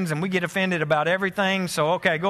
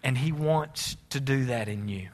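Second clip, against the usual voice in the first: reflective sermon; 3 semitones lower than usual. Acted. Quiet reflective sermon close.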